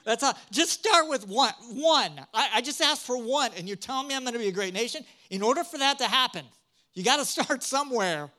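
a half-joking plea that builds to exasperation; pleading with God. Retold or imitated. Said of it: "and I'm thinking".

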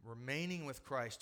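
normal talking voice

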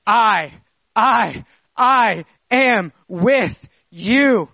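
three emphatic "I"s, then slow spaced "am with you"; stress on I. Shown as a demonstration. Preacher voicing Jesus assuring his followers, hammered "I". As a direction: hammer each "I", stretch every word after. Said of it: "This three-pronged language strategy".

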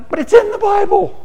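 very high, loud retort; exclaimed retort. Acted. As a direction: pitch it way up in mock exasperation. Acted retort to the objectors.

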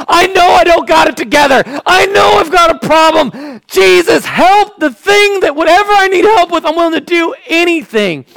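high, loud, pleading shout; crying out for help; desperate cry. Acted. Man confessing his need to Jesus, shouting.

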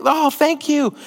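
put-on high gleeful child voice; kid thrilled by a gift. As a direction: gasp it out like a child opening presents. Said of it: "you see their, their thing".

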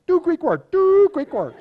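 very high, loud mock-Greek catchphrase; groomsmen mimicking Greek accent. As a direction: parody a Greek accent, punching TWO high. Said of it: "You got groomsmen saying".

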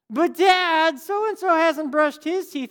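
loud, high, drawn-out tattling; whining kid voice. Retold or imitated. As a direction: drag out a child's complaint to Dad. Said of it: "it's always said in that, like, tone of voice".

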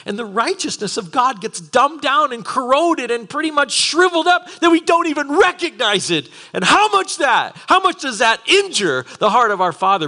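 high, loud, building preacher's cry; impassioned preaching. Real, not acted. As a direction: preach it with rising urgency. Preaches at full force.

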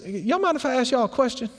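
high-pitched, forward friend; Skin. Retold or imitated. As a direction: ask in a high, cheeky voice. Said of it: "Skin says".

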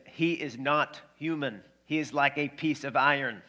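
measured mock-Russian delivery; Russian accent. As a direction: do an amateur Russian boxer accent. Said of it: "That's my Russian accent".

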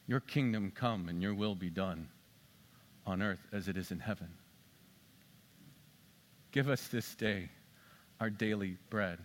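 low, soft, prayerful recital; hushed Lord's Prayer from the pulpit; prayer recitation. Acted.

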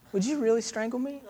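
put-on high, soft small child's worried question; lightly voiced young girl asking; little daughter. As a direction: ask Daddy timidly as a little girl. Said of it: "And she goes".

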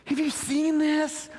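breathless discovery of the scriptures; hushed awestruck wonder; awed whisper. Retold or imitated. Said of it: "and I was like".

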